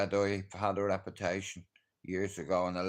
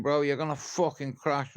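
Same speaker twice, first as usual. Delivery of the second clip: whinging; 6 semitones higher than usual. Retold or imitated. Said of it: "he's whinging on the back saying".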